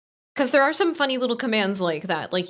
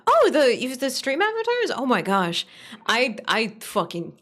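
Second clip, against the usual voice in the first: usual speaking voice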